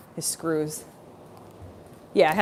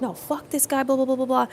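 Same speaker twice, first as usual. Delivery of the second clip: high-pitched retold rant; comic high outburst tumbling into babble; mock upset protest; 7 semitones higher, 3 dB quieter than usual. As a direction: imitate your own worked-up protest.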